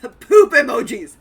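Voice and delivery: big loud laugh; laughing loudly. Acted. Character laughs at poop emojis.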